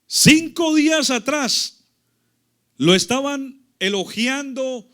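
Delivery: forceful sermon cadence; emphatic preaching. Real, not acted. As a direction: preach the contrast out to the congregation.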